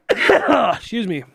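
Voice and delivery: slow, strained after a cough; hoarse after coughing. Real, not acted. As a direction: clear the throat and excuse yourself. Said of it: "Sorry, I'm coughing".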